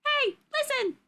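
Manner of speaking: high-pitched fairy helper call; high little game-sidekick cry; Navi. Shown as a demonstration. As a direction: pipe up high to get attention. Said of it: "Oh, Navi".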